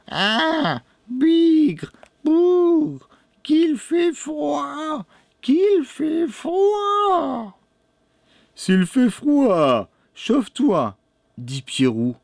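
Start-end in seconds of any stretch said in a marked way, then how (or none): 0.1-7.6 very high, drawn-out witch voice; grumbling old crone. A narrator's reading. Lame old woman complaining of the cold as she comes in, high-pitched grumbling. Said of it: "La boiteuse arriva bientôt en marmonnant". 8.5-11.0 put-on high, drawn-out boy's voice; boy's retort. A narrator's reading. Pierrot tells the old woman to warm herself, high-pitched retort.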